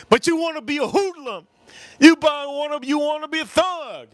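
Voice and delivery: high, pleading scolding, imitated; worked-up high-voiced counselor impression; mad counselor. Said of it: "He got so mad, the counselor".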